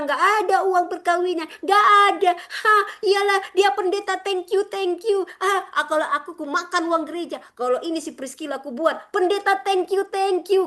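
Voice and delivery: very high, loud mocking voice, slowed and gloating; mocking greedy pastor. Preacher imitating a greedy pastor taking church money, high gloating mockery. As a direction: ham up a smug pastor pocketing the money. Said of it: "jangan kau bilang".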